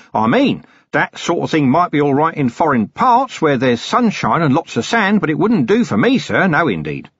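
a cheerful, long-winded hermit eager to explain; chatty old hermit. Acted. Narrator voices the hermit Stump.

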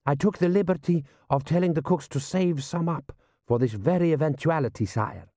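deferential general. Acted. General briefing his prince on the enemy, deferential.

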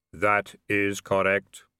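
slow, monotone solemn pronouncement; Death. Acted. Robed figure confirms to Vimes, slow solemn voice. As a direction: intone each word slowly on one note.

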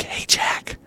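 unvoiced pretend whisper. Whispering to Jack at a concert, pretend. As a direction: hiss it like you don't want to be seen.

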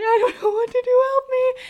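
imitating a tearful begging friend; crying friend. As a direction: whimper it like she's panicking. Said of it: "she was, like, crying".

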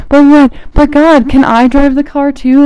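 high, loud mock plea to God; high whiny can-I-drive-too plea; pleading whine. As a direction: beg like a kid asking to drive.

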